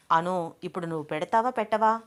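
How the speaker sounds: usual speaking voice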